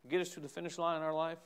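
ordinary talk

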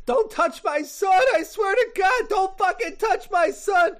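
high-pitched yelled threat; frantic mother. Acted. Karen warning off the man threatening her son, frantic shout. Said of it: "And she's like".

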